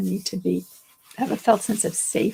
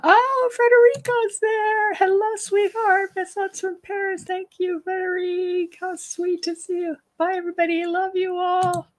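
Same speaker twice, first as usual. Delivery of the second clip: delighted greeting; 10 semitones higher, 3 dB louder than usual. Real, not acted. Host spotting Frederico joining from Paris, high delighted greeting.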